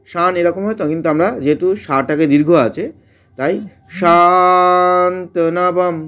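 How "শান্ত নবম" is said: শান্ত নবম with long শা and half-m anusvara; Sanskrit pronunciation. Shown as a demonstration. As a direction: stretch শা, nasal half ম. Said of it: "অনস্বরটা অর্ধেক ম". (অর্ধেক ম দিয়ে শান্ত নবম)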